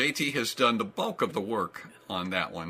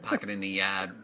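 Boston accent; 4 semitones lower than usual. Shown as a demonstration. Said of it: "Boston accent".

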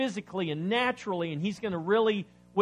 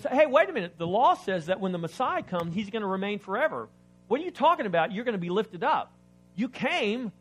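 puzzled crowd; 5 semitones higher, 6 dB louder than usual. Retold or imitated. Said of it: "He goes".

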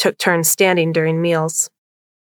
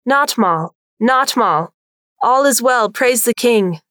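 lightly voiced town crier calling out; 5 semitones higher, slower than usual. A narrator's reading. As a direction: call the hours like a town crier. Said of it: "the town crier's low voice".

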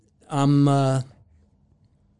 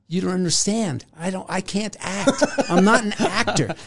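higher, laughing protest, a mild mock panic; half-laughing, insist you can't act; flustered protest; 6 semitones higher than usual. His own panicked protest.

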